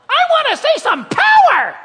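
high, loud shouted cry; sermon shout. Acted. Pastor voicing demanding believers in a high, loud shout. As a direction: belt it to the back row.

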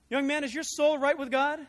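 loud, forthright preacher's challenge; Moody challenging a stranger on the trolley, loud; booming question. Acted. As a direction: put it to him straight and loud.

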